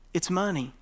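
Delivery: normal talking voice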